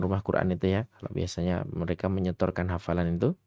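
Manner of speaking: ordinary talk, nothing marked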